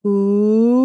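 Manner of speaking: oo sliding up like a siren. Shown as a demonstration. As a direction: glide the oo upward. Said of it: "Repeat the siren with an oo sound".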